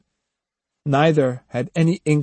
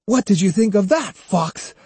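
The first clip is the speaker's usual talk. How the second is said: breathing hard; 8 semitones higher, 3 dB louder than usual. A narrator's reading. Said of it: "Breathing hard".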